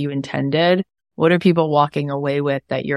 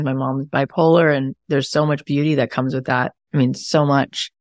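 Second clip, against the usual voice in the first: normal talking voice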